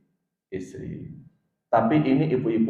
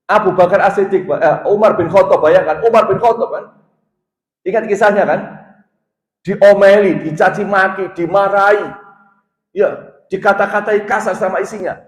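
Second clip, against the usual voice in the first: raised, high and loud preacher's voice; forceful preaching; 8 semitones higher, 9 dB louder than usual. Acted. Preacher telling husbands how Umar was scolded, forceful preaching. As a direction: preach it out loudly at a high pitch.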